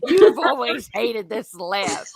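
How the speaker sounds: mock annoyed dad. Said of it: "He's like".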